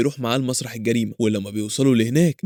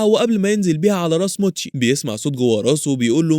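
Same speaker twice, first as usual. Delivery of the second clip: ordinary delivery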